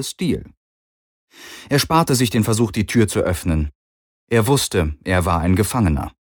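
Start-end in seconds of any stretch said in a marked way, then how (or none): none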